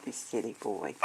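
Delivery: normal talking voice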